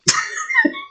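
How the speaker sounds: high laugh. Real, not acted. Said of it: "This is my sexy voice".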